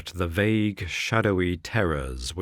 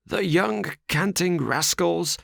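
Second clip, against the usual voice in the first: exclaiming; 11 semitones higher than usual. Acted. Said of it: "he exclaimed".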